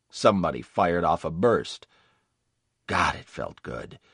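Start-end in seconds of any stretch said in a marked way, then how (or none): none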